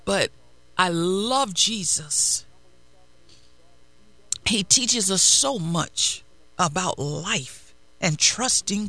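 steady, fervent sermon delivery; preaching. Acted. Pastor preaching to the congregation, fervent. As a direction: drive the message home to the church.

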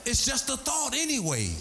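high, ringing sermon climb; preaching at full force. Acted. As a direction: drive it home to the congregation.

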